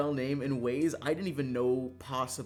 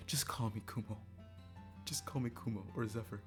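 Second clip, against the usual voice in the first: resigned cut-off; 6 dB quieter than usual. Retold or imitated. Streamer cutting people off mid-attempt, soft and resigned. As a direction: cut in softly with a weary correction.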